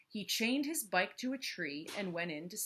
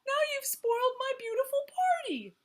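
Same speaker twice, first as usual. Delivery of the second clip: sobbing; 7 dB louder than usual. Acted. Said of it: "he sobbed".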